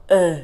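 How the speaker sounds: E as a loud throaty French "euh"; French E sound. Shown as a demonstration. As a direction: push E out like coughing something from the throat. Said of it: "at the same time cough".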